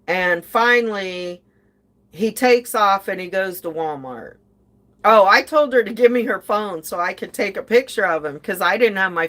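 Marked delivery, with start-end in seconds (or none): none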